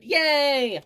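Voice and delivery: high, loud little cheer; excited cheer. Real, not acted. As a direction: squeal it happily. Cheering after eating.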